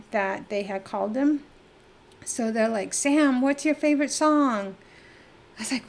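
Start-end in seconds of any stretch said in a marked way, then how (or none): 2.9-4.8 excited friends. Retold or imitated. Friends voiced asking on phone.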